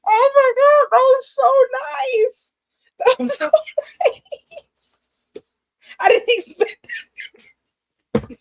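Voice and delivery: real crying, very high and loud, fading to a squeak; moved to tears. Real, not acted. Host floored by her friend's unexpected compliment, crying. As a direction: break into happy sobs. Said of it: "Are you crying?".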